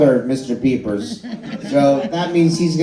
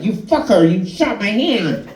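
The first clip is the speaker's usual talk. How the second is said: reflex whine; 5 semitones higher than usual. Retold or imitated. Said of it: "I'd be like".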